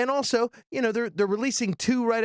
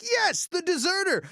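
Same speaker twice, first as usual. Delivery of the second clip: higher, triumphant little cheer; mock victory cry, pitched up; excited yes; 11 semitones higher than usual. Reviewer's own excited reaction retold.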